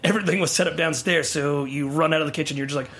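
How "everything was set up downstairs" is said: ordinary delivery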